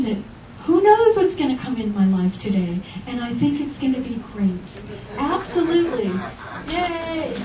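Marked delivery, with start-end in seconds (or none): 5.2-7.3 much higher, bright yay of joy; joyful cheer. Shown as a demonstration. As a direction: cheer the day ahead. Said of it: "look at my body language".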